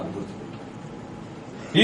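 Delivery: slow whisper; hushed slow whisper to the flock; whispered reminder. Real, not acted. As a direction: lean in and whisper it.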